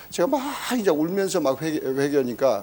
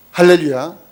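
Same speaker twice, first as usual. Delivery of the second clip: loud rising hallelujah; exultant hallelujah shout; joyful shout; 11 dB louder than usual. Real, not acted. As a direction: cry out the praise. Preacher exclaims praise.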